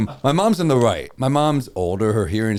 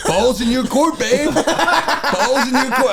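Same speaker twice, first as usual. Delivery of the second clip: high, sing-song taunting voice, much higher; mocking the roommate; 10 semitones higher, much slower than usual. Roommate taunting his girlfriend on the phone, high mock voice. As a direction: drawl it out, mocking a smug boyfriend.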